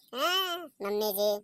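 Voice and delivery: ordinary talk, nothing marked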